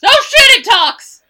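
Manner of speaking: very high, loud astonished outburst; shocked shout. Acted. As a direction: yell it out, stunned by the discovery. Shocked that the opossum talks.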